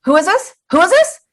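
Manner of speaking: rude caller imitation, pitch jumping up; mock curt, high-pitched questioning. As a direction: demand it like an impatient stranger on the phone. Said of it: "she was so rude to me on the phone".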